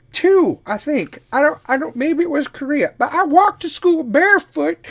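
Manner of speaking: put-on high, slow, indignant old-lady voice; cranky old grandma. Grandma defending herself to grandkid, high indignant old-lady voice. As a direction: bristle like a proud old grandmother. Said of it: "you're walking around naked, Grandma".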